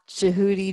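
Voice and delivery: Jehudi as "juh-HOO-dee"; variant name pronunciation. Shown as a demonstration. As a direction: Jehudi with a long oo in the middle. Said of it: "many different ways of pronouncing his name".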